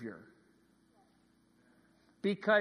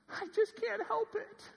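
put-on very high little-girl falsetto; imitated child's helpless squeal; four-year-old daughter. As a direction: throw it out like an exasperated small child. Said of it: "my four-year-old said something".

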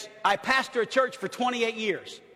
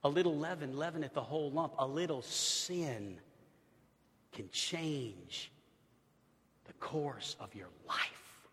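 softer, slow and grave; quiet solemn point; 7 semitones lower, 11 dB quieter, slower than usual. Real, not acted. Pastor lowers his voice to the congregation, slow and soft. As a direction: drop the voice to drive it home.

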